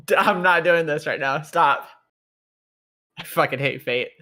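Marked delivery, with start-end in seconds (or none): 0.1-1.9 raised, louder plea to quit; playful, fed-up objection; exasperated protest. Real, not acted. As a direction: protest through amusement, higher and louder. Protesting the teasing after laughing.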